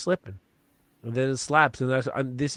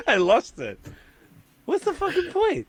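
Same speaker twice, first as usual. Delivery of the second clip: talking high through real laughter; words squeezed out while still laughing; 5 dB louder than usual. Real, not acted. Still cracking up with the hosts, he laughs out the words. Laughing after losing his point.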